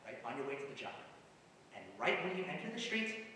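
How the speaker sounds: normal talking voice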